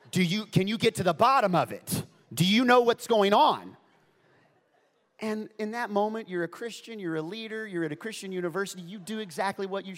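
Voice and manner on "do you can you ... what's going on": loud, raised imitation of an RA; mock-exasperated loud questioning; indignant demand. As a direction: demand answers down the phone. Acted upset RA demanding answers.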